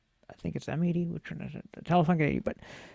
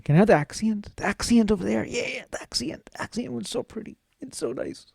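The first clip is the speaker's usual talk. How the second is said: high, soft put-on pleading voice; pleading wanter; 5 semitones higher, 4 dB quieter than usual. Customer begging for the prettier one over there, mock pleading. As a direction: coo over it like a coveted toy. Acts out someone begging for gear.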